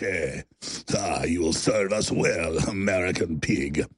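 very low, slow Eastern European villain voice; mock a menacing Eastern European heavy; drawn-out, deep put-on Eastern European accent; Gorka impression, Eastern European accent. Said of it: "But this is how he talks".

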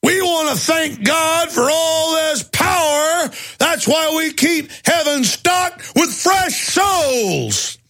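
high, slow shouted sermon voice; drill sergeant shout. Retold or imitated. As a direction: bellow it like a crazed sergeant. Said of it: "the sergeant in Full Metal Jacket".

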